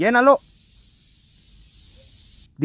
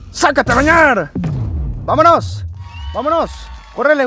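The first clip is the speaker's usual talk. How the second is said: excited yelling; 6 semitones higher than usual. Real, not acted. Gamer rushes the team out before time runs out, shouting. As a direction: urge the team on at full voice. Yelling during timed escape.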